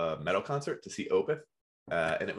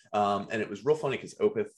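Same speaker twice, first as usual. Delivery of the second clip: ordinary talk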